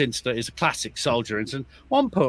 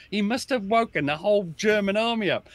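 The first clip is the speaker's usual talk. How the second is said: ordinary delivery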